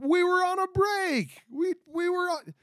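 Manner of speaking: indignant Ross catchphrase, hammed up; mock Ross whine. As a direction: throw up your hands like Ross, pleading. Mimicking Ross's famous line.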